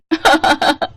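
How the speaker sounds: short sneering laugh, falling; mocking laugh. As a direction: laugh at her scornfully. Mocking laugh after taunt. (तिरस्कार भरी हँसी)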